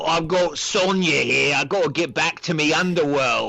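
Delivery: lightly put-on British hooligan accent; British hooligan talking through his chicken wing; Kano impression. Kano gloating about Sonya, put-on British hooligan accent. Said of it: "and he's like".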